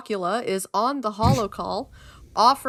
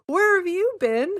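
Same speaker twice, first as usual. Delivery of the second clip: excited; 11 semitones higher, 4 dB louder than usual. Real, not acted. Greeting returning character excitedly.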